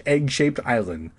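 ordinary talk, nothing marked

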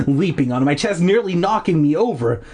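plain reading voice

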